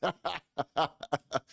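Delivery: a real laugh bursting out; hearty laugh. Real, not acted. Laughing at the clip.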